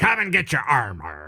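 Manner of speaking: lightly voiced pirate. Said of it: "it's just a pirate voice".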